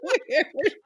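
slow, high, through laughter; giggling disbelief; laughing. Real, not acted. Laughing after the quote.

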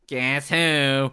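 drawn-out put-on receptionist voice; desk woman impression. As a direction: play the cartoon desk clerk, stretching the words. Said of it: "The desk woman".

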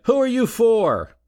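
high, pushy heckling shout; heckler's demand. Retold or imitated. A heckler demands Bryan name his man, with a high jeering shout. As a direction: demand it from the floor. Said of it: "a heckler said".

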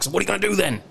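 high-pitched, confrontational mock yell; imitated angry customer. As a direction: act out a furious customer's challenge. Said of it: "was just immediately screaming at me".

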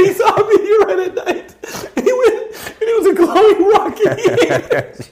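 squealing high through laughter; helpless laughter. Real, not acted. Host cracking up at his own silly character, helpless laughter. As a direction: try to talk while cracking up.

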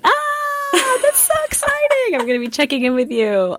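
high excited squeal. Real, not acted. Bride thrilled at another bride's close wedding date, excited. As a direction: squeal it out with delight.

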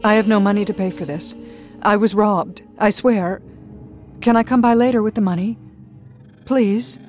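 loud, high desperate plea; desperate pleading. Acted. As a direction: beg frantically.

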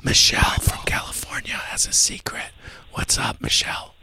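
hushed radio host whisper; breathy secretive whisper on air. Acted. Host whispers all show.

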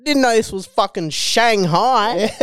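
high, slow mock indignation with a laugh; laughing while mocking a bigot; mock outraged bloke. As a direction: drawl it out like a bigoted uncle. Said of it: "what's going on here".

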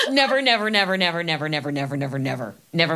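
insistent then trailing repetition; emphatic nevers. Real, not acted. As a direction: hammer never then let it die away. Emphatic repeated never, fading.

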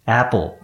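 Apple with the first vowel said open-mouthed. Shown as a demonstration. Teacher shows English needs an open mouth, wide-open vowel. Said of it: "口開けないと喋れない".

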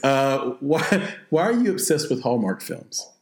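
ordinary delivery

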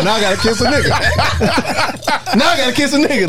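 high laughing voice. Real, not acted. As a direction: laugh through the words. Laughing at own joke.

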